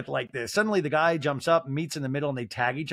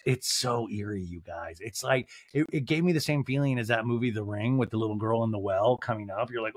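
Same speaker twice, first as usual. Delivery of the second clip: ordinary talk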